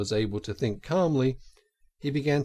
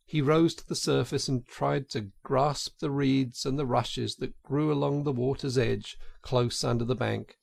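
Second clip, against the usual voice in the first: plain reading voice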